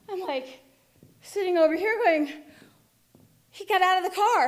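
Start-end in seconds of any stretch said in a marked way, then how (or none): 3.5-4.5 higher-pitched, moderate-volume surprise; startled thought, not yet shouted; dawning realisation. Retold or imitated. Realisation, first take before louder repeat.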